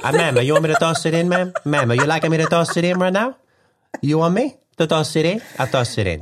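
put-on high, eager Italian accent, questions rising; Italian stock boy accent. Stockroom boy offering help to a ma'am, high Italian accent. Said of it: "the stockroom boy in some cafe in Italy".

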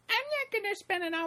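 very high squeaky imitation of an objection; exaggerated falsetto complaint; mock protest. Retelling a guest's protest.

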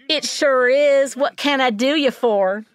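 slow, high, exaggerated Southern drawl; syrupy Southern accent. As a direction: stretch it out like a syrupy Southern flirt. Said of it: "a syrupy and exaggerated Southern accent drawls".

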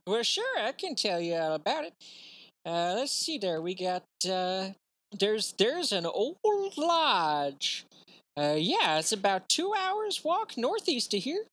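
put-on high Southern old man; folksy Southern old-timer rambling; Southern accent. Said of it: "Why is everyone Southern?".

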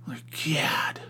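whispered exasperation. Real, not acted. Exasperated aside.

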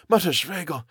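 put-on high, halting, accented character voice; dazed, faltering high voice; mumbling foreigner. A narrator's reading. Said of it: "Kurtz mumbled".

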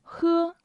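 喝 held high and level; 喝 as a high first-tone model. Shown as a demonstration. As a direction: keep 喝 on one high, even pitch. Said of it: "the first tone".

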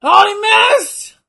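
high, loud, drawn-out cry; excited shout. Real, not acted. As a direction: shout it out, slowing down. Reacting to a missed move.

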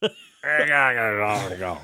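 hoarse voice, laughing; rough throat, chuckling through it; raspy voice. Real, not acted. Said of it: "what my voice sounds like".

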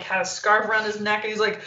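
normal talking voice

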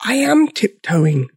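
slow, high little dinosaur voice; tiny voice. A narrator's reading. Big dinosaur answering grumpy George, tiny voice. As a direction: protest in a tiny high-pitched voice. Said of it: "in his tiny voice".

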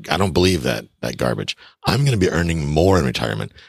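usual speaking voice

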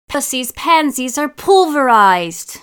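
high, loud wail of dismay; horrified outcry. A narrator's reading. Boy discovers the neighbour's wrecked flowers, horrified outcry. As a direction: cry out in theatrical horror.

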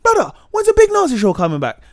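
high, loud excited greeting; excited prisoner shout. Retold or imitated. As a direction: shout it across the prison yard. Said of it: "man was walking in the prisons and like".

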